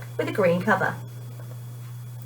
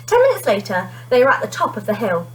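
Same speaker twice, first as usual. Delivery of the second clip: ordinary delivery, nothing marked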